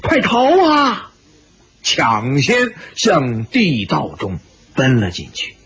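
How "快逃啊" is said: high, urgent cry to flee; mild narrated shout, pitched well up; shouted alarm. A narrator's reading. Someone in the crowd urging others to flee, shouted alarm. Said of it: "有人大叫".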